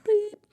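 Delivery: high-pitched mock bleep; censor beep. Host cutting off "what the", high censor beep. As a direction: imitate a censor beep over a swear. Censors own cut-off swear.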